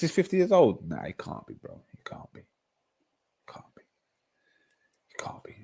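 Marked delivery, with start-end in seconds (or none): 0.9-3.8 falling softer and softer in denial; fading disbelief. Real, not acted. Streamer refusing to believe [name] is fifty, fading to near silence. 5.1-5.5 a stunned whisper; whispered disbelief. Real, not acted. Streamer whispering that [name] can't be fifty.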